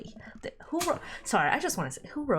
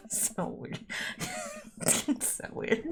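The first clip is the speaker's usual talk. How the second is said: voiceless words lost in laughter; real wheezy giggle, barely getting words out; giggling; 7 dB quieter, slower than usual. Real, not acted. Reader reacts to the script, giggling helplessly.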